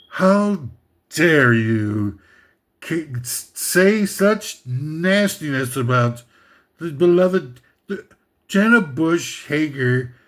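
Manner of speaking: slow, prissy, scandalized voice; mock outraged fan. Offended fans scold him for listing her, slow prissy outrage. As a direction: lay on the offended pearl-clutching fan. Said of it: "and you're thinking, like".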